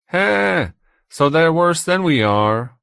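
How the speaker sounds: high, loud, slow character voice; gloating flame. A narrator's reading. Another flame interjects, voiced by narrator.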